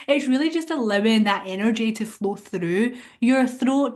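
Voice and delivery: usual speaking voice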